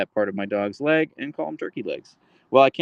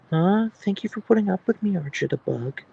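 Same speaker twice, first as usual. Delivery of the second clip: higher cooing thanks; 8 semitones higher than usual. Real, not acted. Owner cooing thanks to her dog, baby talk.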